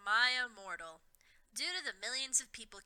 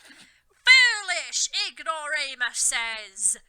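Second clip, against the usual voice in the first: very loud, very slow gloating bellow; villain bellow; 3 semitones higher, 14 dB louder, much slower than usual. A narrator's reading. Voldemort yelling from his broomstick, slow loud bellow. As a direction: boom it down from above. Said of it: "yielded Voldemort from his broomstick".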